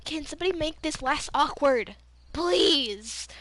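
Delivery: loud, pleading retold cry for rescue; exasperated plea. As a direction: beg your friends, half joking. Acting out awkward plea to friends.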